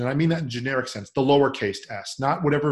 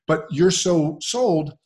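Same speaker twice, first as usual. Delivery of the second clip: ordinary delivery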